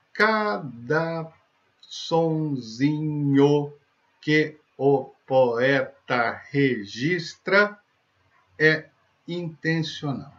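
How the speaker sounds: very slow, word by word; sounding out words. Shown as a demonstration. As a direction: spell out each little sound slowly. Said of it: "E ele é composto sílaba por sílaba".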